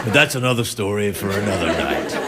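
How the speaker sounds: deadpan aside. Real, not acted. Host tossing a dry aside to the audience, low deadpan.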